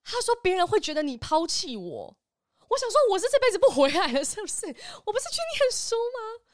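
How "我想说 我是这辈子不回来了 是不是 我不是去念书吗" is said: high, loud laughing protest; giggling indignation; laughing disbelief. Real, not acted. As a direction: laugh it off in outrage.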